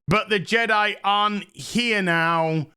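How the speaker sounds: normal talking voice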